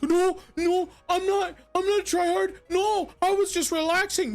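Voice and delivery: high squeaky whiny mock voice; cute anime streamer. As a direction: mimic a defensive whiner, way up high. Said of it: "they're like".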